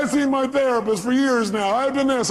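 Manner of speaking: high-pitched imitation of a complaining caller; lightly put-on whine of excuses; mock whining.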